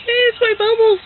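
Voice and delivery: high-pitched mock kid wail; crying kids. Kids protesting their stolen birthday bubbles, mock crying. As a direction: whine it high like an upset child. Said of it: "they all cried, and they're like".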